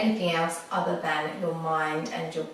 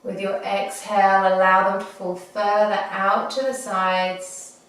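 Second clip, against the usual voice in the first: guided meditation. Acted. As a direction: ease them gently into the breath. Leads a body scan meditation.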